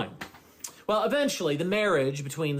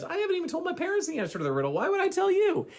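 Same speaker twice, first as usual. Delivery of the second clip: high, fast, indignant comeback; exasperated retort; 14 semitones higher, much faster than usual. Retold or imitated. Samson snapping back at his nagging wife, high and fast. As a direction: fire it back at her, much higher and quicker.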